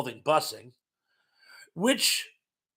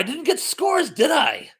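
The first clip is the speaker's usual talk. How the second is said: high-pitched, louder self-catching exclamation; sudden realization; 11 semitones higher, 5 dB louder, slower than usual. Real, not acted. Quiz host realizing the scores were forgotten, high and surprised. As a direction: catch your own mistake, voice jumping up high.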